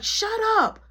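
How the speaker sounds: very high-pitched irritated snap; mad girl. Retold or imitated. Annoyed cellmate silencing the yeller, high angry snap. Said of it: "other girl was getting mad".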